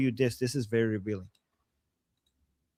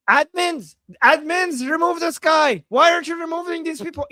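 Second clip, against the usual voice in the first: high, loud, indignant calling; angry demand; 9 semitones higher, 4 dB louder than usual. Retold or imitated. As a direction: demand it from the admins. Voicing angry commenter calling admins.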